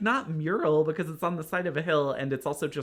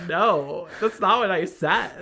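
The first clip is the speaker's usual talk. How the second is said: louder, exasperated correction; fed up; 3 semitones higher, 5 dB louder than usual. Retold or imitated. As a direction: set him straight, done with the question. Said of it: "totally over it".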